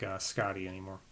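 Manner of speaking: usual speaking voice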